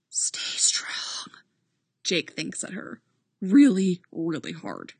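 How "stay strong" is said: voiceless urgent whisper. Acted. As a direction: breathe it as a silent plea. Thought sent silently to partner.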